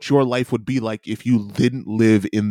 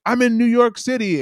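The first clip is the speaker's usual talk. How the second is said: mock tourist gush; 12 semitones higher than usual. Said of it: "people from other countries being like".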